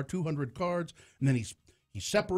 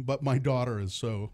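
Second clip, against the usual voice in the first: ordinary delivery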